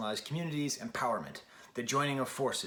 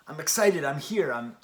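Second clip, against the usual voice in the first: much louder, giddy I'm excited; voicing the thrilled listener; 3 semitones higher, 8 dB louder than usual. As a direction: play the fan swept up by the song. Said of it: "you hear it and you just".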